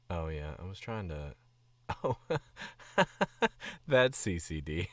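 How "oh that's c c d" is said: loud burst of real laughter around the words; laughing. Real, not acted. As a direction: crack up while talking, loud and high.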